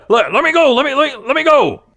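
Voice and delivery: high, loud, stammered pleas; panicked struggling. Acted. Restrained man to his captor, high panicked yelling. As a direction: struggle to get free as you yell.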